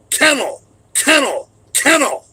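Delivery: loud command repeated three times; shouted three times. Retold or imitated. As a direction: bark the command at the dogs. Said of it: "I had to say the word three times".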